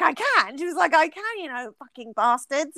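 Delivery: high-pitched, lightly mocked friend voice; a hint of a giggly tipsy pal; imitating tipsy friend. Friend offering to bitch about men.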